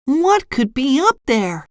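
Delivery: high, curious cartoon voice; SpongeBob wondering. A narrator's reading. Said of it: "SpongeBob wondered".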